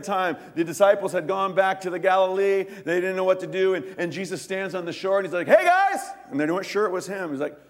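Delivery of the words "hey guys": loud, high call across the water. Retold or imitated. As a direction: hail friends from far off. Jesus calls from the shore.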